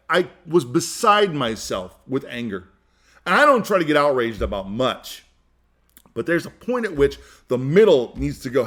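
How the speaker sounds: ordinary talk, nothing marked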